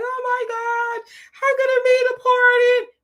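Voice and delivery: hammed-up shocked dupe, stretched high and slow; mocking gullible listener. Mocking the lawyer's gullible audience in a high, slow, shocked voice. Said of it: "people who are gonna be like".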